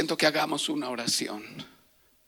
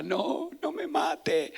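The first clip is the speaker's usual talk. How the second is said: slow, higher mock plea for life; retold pleading cry; 6 semitones higher, much slower than usual. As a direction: imitate a victim begging, without real volume. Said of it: "Y él me gritaba". (súplica gritada, imitada a media voz)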